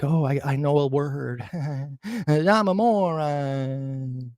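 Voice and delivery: a lightly voiced smug fool, stretched out at the end; mock someone proud of a big word; lightly hammed-up dim voice, slow on "I'm a moron". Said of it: "It makes them feel like".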